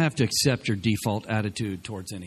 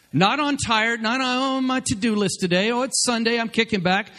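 lazy churchgoer; 10 semitones higher than usual. Retold or imitated. Mocking lazy Sunday attitude.

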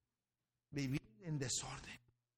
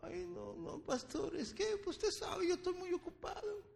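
high, whining mock of a busy believer; whiny churchgoer. Pastor imitating a member excusing himself, high whine.

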